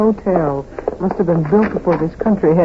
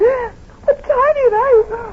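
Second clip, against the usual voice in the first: crying. Acted. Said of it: "Please, try and get a hold of yourself".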